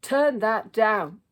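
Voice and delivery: slow, very loud, high order; yelled. A narrator's reading. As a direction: bark the order, furious. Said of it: "yelled Dad".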